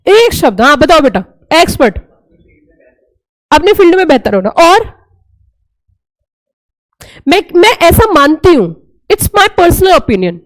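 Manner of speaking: ordinary talk, nothing marked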